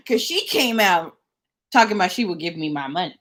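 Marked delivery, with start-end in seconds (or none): none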